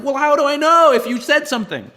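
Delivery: hammed-up frustrated colleague; exasperated coworker. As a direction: throw up your hands in a squeal. Acted quarrel with how types.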